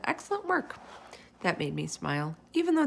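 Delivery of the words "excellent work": teacher praise. A narrator's reading. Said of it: "she said".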